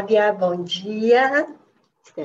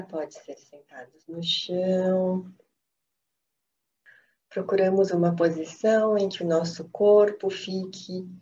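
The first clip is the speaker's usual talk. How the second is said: calm, unhurried meditation voice with long pauses; guided meditation; 3 semitones lower than usual. Acted. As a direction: guide them gently into stillness, phrase by phrase.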